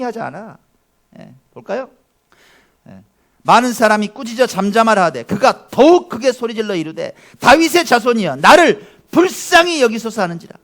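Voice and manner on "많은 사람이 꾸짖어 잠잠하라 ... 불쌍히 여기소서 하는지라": loud, raised reading of the verse; scripture reading. Acted. Pastor reads the Bartimaeus verse to the congregation, loud and raised. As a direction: proclaim the verse to the hall.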